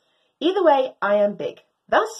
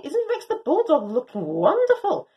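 ordinary delivery

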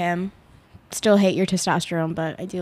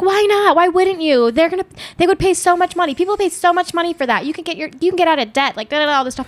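insistent mom voice; 9 semitones higher, 4 dB louder than usual. Retold or imitated. Imitating her mom pushing.

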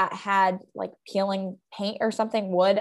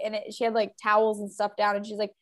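usual speaking voice

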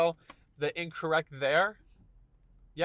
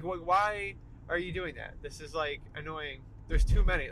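ordinary delivery

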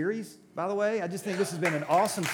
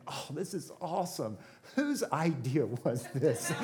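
playfully pleased with yourself, drawing out the question; mock self-congratulation; 3 dB quieter, slower than usual. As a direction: savour it slowly, as a retold thought. Retells his own self-satisfied thought.

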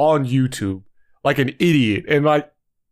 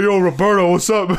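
butch greeting; 6 semitones higher than usual. Retold or imitated. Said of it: "Like, mad butchy".

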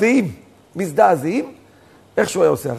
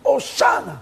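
higher-pitched retold cry; imitated congregational cry; 5 semitones higher, slower than usual. Rebbe leading endangered congregation crying out, retold call. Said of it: "כולנו עכשיו צועקים".

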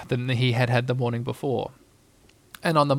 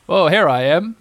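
louder, growly bear voice; 3 semitones higher, 7 dB louder than usual. A narrator's reading. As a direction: answer in a light bear growl. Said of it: "said a growly voice behind him".

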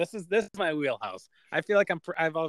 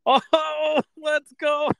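a real whoop of triumph, much higher and louder; excited shout; 10 dB louder, much slower than usual. Real, not acted. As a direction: cheer like you just won the point. Celebrates guessing the answer right.

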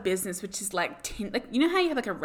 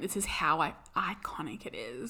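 whispering; 4 semitones lower, 5 dB quieter than usual. Real, not acted. Whispering how iconic.

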